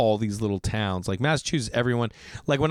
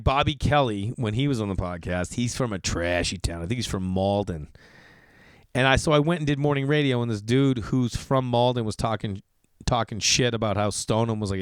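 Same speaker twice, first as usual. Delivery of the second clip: normal talking voice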